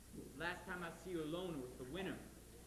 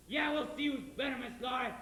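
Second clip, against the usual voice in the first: loud, higher threatening yell; threatening shout; 6 semitones higher, 8 dB louder than usual. Acted. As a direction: bellow the threat at her. Judd threatens Laurie.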